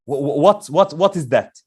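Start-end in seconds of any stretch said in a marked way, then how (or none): none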